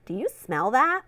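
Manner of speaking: plain reading voice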